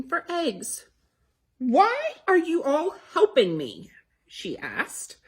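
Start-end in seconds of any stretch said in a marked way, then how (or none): 1.6-3.9 high, loud, slow question; suspicious question. Acted. Betty Bunny questioning her siblings, high and slow. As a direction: stop and demand an answer.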